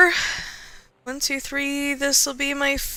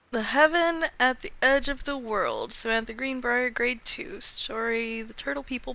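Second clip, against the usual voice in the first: usual speaking voice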